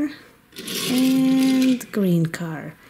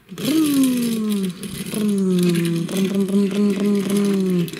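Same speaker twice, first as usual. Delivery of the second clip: engine vroom; 4 semitones lower, slower than usual. Pretend. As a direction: rev the toy car hard down the track. Car engine noise during toy race.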